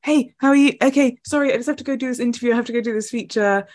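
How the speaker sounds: mock a breathless dash-by chat; rushed greeting. Two friends passing at golf courses, rushed mock greeting.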